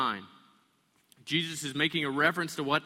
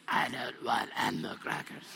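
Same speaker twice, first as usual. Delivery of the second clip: put-on lower, strange, growled kid's voice; low demon voice; 3 semitones lower than usual. Pastor mimicking his kid as a creepy growl. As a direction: make the kid sound possessed and eerie. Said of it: "But it sounds more like this".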